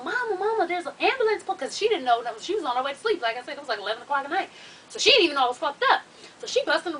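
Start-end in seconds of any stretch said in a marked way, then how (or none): 0.0-1.4 put-on higher-pitched, mildly alarmed child calling Mama; lightly acted scared call; imitating scared daughter. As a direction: mimic a frightened kid raising the alarm. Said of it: "scared, 'cause she's like".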